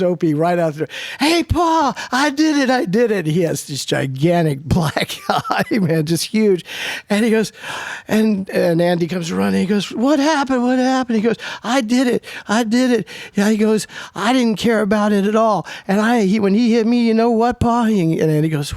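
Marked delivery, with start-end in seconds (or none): 1.2-3.3 put-on excited kid. Excited kid shouting, much higher. 9.9-11.2 worried father. Retold or imitated. 11.6-13.1 put-on proud kid. 14.1-17.8 put-on proud kid.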